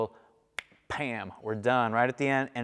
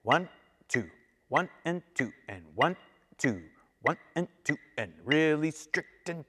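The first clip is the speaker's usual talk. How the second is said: even, strictly timed counting; counting in strict time. Shown as a demonstration. Teacher counting steady beats for student. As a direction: count the beats evenly like a metronome. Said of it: "feels like this".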